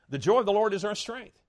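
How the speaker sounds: usual speaking voice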